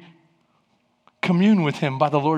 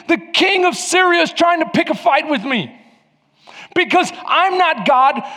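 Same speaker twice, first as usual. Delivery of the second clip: high, loud, frantic panic; the king of Israel panicking that Syria wants a fight, higher and louder; panicked king; 8 semitones higher, 4 dB louder than usual. Acted. King of Israel reading the letter, frantic and panicked. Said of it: "Freaks out".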